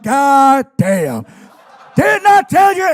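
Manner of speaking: loud, high ranting shout; wrestling promo rant. Retold or imitated. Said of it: "starts cutting a promo on me".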